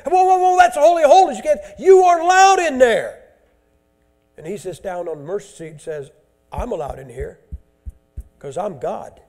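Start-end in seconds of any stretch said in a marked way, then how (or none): 0.0-3.2 loud, high, frantic warning shout; alarmed shouting. Acted. Temple priests shouting at the Antichrist to stop, loud and high. 6.5-9.2 low quiet arrogance. Acted. Antichrist sits on mercy seat.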